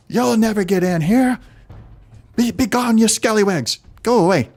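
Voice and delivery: high shouted taunt through a megaphone. Acted. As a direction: yell it like over a loudspeaker. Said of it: "what sounds like somebody on, a megaphone or a speakerphone".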